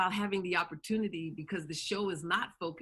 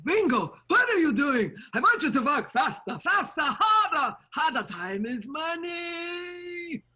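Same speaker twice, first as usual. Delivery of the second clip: put-on higher and louder, a pushy old storekeeper; 11 semitones higher, 8 dB louder than usual. Old store owner rushing the boy at work, loud and high. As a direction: bark like a demanding old shop owner, stretching the last words.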